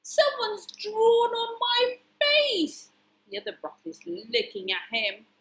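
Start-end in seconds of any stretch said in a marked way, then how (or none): 0.0-2.9 high, loud, slow wail; hammed-up outraged broccoli; distressed cry. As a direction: wail in comic dismay. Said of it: "cried Broccoli".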